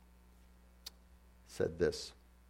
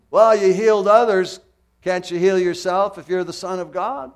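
loud, high jeering challenge; mocking taunt; 10 semitones higher, 11 dB louder than usual. Mockers jeering at Jesus on the cross, loud and high. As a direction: sneer it up at the man on the cross. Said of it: "Then they mocked him".

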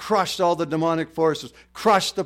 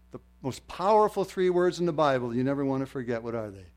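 usual speaking voice

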